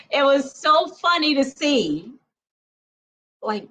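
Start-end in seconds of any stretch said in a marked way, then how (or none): none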